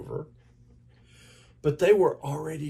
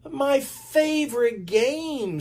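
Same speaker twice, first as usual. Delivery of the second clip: put-on much higher, slow delighted kid; eager reply; 13 semitones higher, 3 dB louder, slower than usual. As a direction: savour the answer. Bill answers about jacks.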